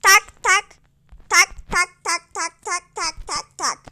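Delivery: tapping count. Shown as a demonstration. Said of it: "Devleri şu şekilde salıyorsunuz".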